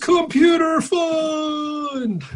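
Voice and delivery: booming slow announcer; hammy telethon announcer. Pretend. Announcer-style fundraising pitch.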